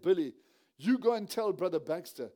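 loud call to his son across the room. Retold or imitated. Father calling loudly to his teenage son Billy. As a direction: raise the voice to send the boy off.

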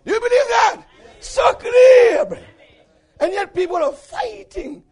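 high, loud call to the congregation; impassioned preaching. Real, not acted. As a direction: cry it out from the pulpit. Calls out to the congregation.

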